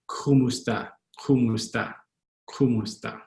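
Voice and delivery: "kumusta" with the k back in the throat; throaty first k on "kumusta"; throaty Tagalog K. Shown as a demonstration. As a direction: start "kumusta" from deep in the throat. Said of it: "it's more in your throat".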